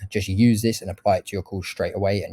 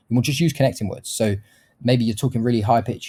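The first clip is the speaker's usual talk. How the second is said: usual speaking voice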